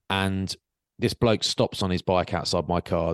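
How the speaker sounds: usual speaking voice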